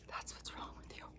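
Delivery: hushed, whispered quote of a blaming mother; imitated mother's blame. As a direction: whisper it as the accusing mom. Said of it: "and she was like".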